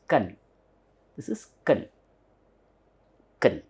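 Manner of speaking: "kan" softly, as an unstressed "k'n"; swallowed syllable. Shown as a demonstration. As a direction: say "kan" with the vowel almost swallowed. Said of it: "this need not be pronounced much".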